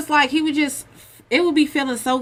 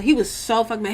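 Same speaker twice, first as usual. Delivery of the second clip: ordinary talk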